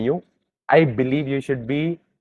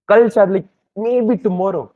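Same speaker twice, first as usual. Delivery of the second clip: quoted hope, pitched up and louder; hopeful; 6 semitones higher, 5 dB louder, slower than usual. Retold or imitated. Retelling a prisoner's dream of getting out, hopeful. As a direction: lift it suddenly, imitating a prisoner's hope. Acting out jailed man's nightly hope.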